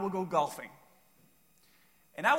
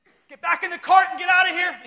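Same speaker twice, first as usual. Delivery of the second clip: high, loud panicked shout; hammed-up alarm at the friend; joking fake panic, urging a getaway; mock panic; 13 semitones higher, 11 dB louder than usual. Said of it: "my first response is like".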